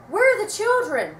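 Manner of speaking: high, loud frantic holler. A narrator's reading. Mother searching for her children, hollering loudly. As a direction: holler for the missing kids. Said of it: "she hollered".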